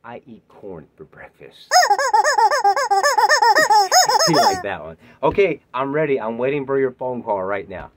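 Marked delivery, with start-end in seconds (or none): 1.8-4.2 loud high cackle; high burst of laughter. Real, not acted. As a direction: cackle at your own joke. Laughs at own corn joke.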